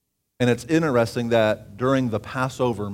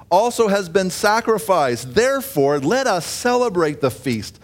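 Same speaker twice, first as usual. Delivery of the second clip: raised, louder preaching voice, pushed high; fervent preaching; 8 semitones higher, 6 dB louder than usual. Acted. Preacher proclaiming to the congregation, fervent and raised. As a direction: preach it out with fire to the room.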